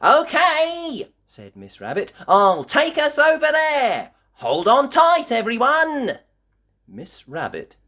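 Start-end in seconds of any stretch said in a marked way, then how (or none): 0.0-1.1 high, loud, bright "okay"; eager character voice. A narrator's reading. Miss Rabbit agrees to sail over. 2.3-6.2 high, loud excited skipper; rally the passengers loudly, way up high; Miss Rabbit calling out. A narrator's reading. Miss Rabbit calls to her passengers.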